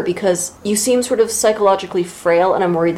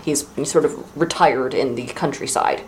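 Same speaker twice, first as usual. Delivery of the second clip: ordinary talk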